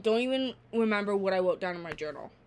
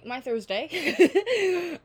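drawn-out and laughing; 9 semitones higher, 6 dB louder, much slower than usual. Real, not acted. Closing her story about Thursday, slowly laughing. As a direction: stretch it slowly, breaking into a laugh.

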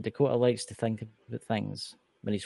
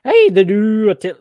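loud, high-pitched stab at an Irish accent; Irish accent attempt; 8 semitones higher, 13 dB louder than usual. Shown as a demonstration. Co-host asked for his best Irish, loud Irish accent attempt. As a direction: burst into a hammed-up Irish accent. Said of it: "Give me your best Irish accent".

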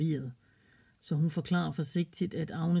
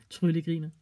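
ordinary delivery, nothing marked

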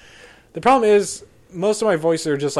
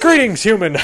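higher, louder, stiff formal greeting; mock alien greeting; 7 semitones higher, 7 dB louder than usual. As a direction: greet a human like a visiting alien. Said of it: "Most of them are like".

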